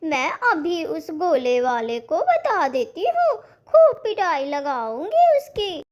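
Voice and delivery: high, emphatic mom voice; a mother hushing her son and vowing revenge; indignant mother. Acted. Mother comforts, threatens revenge.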